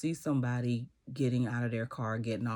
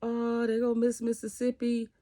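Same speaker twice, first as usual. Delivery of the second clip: much higher, thrilled squeal; excited cheer; 11 semitones higher, 3 dB louder than usual. Retold or imitated. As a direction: squeal the name with delight. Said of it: "And they start".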